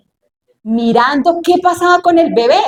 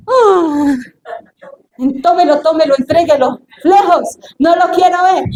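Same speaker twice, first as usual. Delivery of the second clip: exasperated man; 4 semitones higher than usual. Acted. Ordinary person handed a crying baby, exasperated rejection. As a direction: push the crying baby away with the voice. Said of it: "Un hombre, cualquier persona normal".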